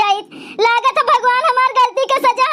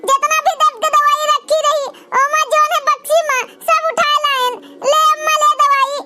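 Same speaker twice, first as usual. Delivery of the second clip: put-on higher little girl in the village; young girl voice; 5 semitones higher than usual. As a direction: fuss over the sick mother like a small child. Young daughter voiced by same actor.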